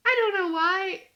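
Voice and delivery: ordinary talk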